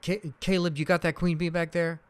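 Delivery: mock call to someone behind you; imitating calling back. Retold calling to Caleb in back.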